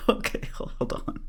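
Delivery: breathy laugh running into the words; laughing in disbelief. Real, not acted. Vlogger reacting to the clip, laughing in disbelief. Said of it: "I know I'm laughing".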